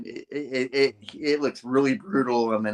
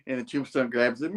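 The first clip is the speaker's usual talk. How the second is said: normal talking voice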